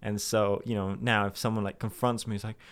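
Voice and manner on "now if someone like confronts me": usual speaking voice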